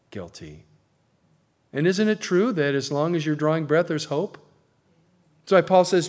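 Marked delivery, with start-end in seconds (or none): none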